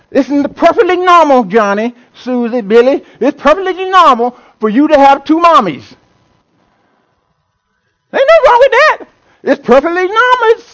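high, sing-song mock reassurance; mocking teacher. Preacher mocking Massachusetts schools to the church, high sarcastic lilt. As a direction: parody the school telling kids it's fine.

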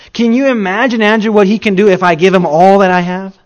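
loud, high, wide-eyed wonder; boy bursting with amazement; excited boy. Retold or imitated. Boy marvels to Andrew at the miracle, loud and amazed.

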